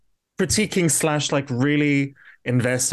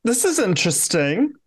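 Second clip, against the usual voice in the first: stunned reaction; 10 semitones higher, much slower than usual. Retold or imitated. Mimicking her stunned face at screen.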